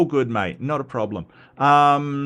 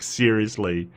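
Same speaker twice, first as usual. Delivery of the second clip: normal talking voice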